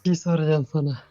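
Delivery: loud faked male voice; forced deep voice. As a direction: boom it out like a guy. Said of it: "Ben de kız olup erkek sesi çıkarıyorum".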